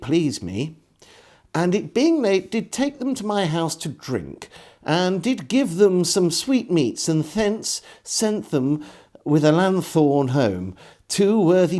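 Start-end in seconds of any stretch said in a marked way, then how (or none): none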